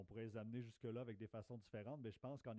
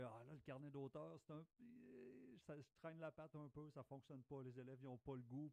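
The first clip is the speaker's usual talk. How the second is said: higher-pitched retold complaint; imitating reluctant colleague; 4 semitones higher than usual. Teacher quoting a colleague struggling with author notebooks, higher reluctant complaint. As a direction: voice a colleague's hesitant grumble, pitched up. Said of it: "je disais à une collègue dernièrement qui demandait".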